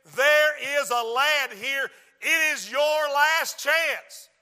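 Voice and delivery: slow, loud Mississippi preaching imitation; Mississippi accent. Brother Percy warning someone in church, Mississippi accent. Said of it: "in his Mississippi accent".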